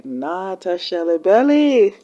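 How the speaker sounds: slow, stretched "Not a Shelly Belly"; drawn-out repeat. Real, not acted. Reactor scolding Franny's pursuers, "Not a Shelly Belly" drawn out. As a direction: draw out "Not" and "Belly" for emphasis.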